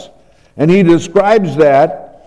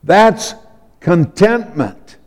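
usual speaking voice

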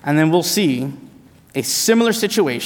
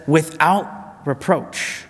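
slowed emphasis; 4 dB quieter, much slower than usual. Acted. Key phrase of the sermon.

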